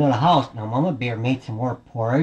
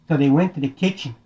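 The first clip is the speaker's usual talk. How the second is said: usual speaking voice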